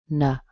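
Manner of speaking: na short and weak, "nuh"; weak schwa. Shown as a demonstration. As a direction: drop off on na with a schwa. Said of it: "a schwa at the end".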